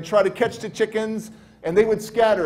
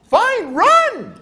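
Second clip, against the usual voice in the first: very high, loud "fine"; imitated exasperated man; exasperated shout; 7 dB louder than usual. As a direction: yell it as a send-off. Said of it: "like that".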